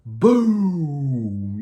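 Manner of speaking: loud mock explosion "Boom!"; a big read-aloud boom. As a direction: blast it out like dynamite. Dynamite explodes in chimney.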